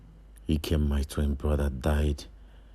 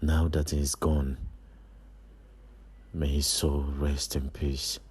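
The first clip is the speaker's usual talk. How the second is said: very low, hushed voice; low, subdued musing with long pauses; sombre brooding; 15 dB quieter than usual. Acted. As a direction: think aloud in mourning, softly. Brooding alone over brother's death.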